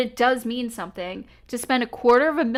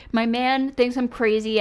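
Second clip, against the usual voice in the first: ordinary talk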